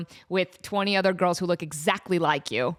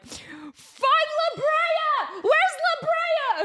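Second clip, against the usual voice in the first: high, stretched-out mock scream of a frantic mom; mock mom yell; much slower than usual. Mom yelling at her twelve-year-old in traffic, high drawn-out mock scream. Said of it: "screaming at her twelve-year-old".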